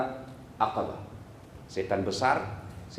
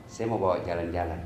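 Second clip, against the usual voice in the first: Ibrahim answering; 13 semitones lower, 8 dB quieter than usual. Acted. Ibrahim answers wife, low.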